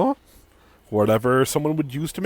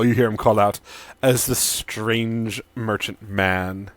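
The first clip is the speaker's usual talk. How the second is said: ordinary talk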